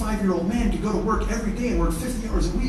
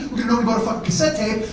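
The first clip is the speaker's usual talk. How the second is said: ordinary talk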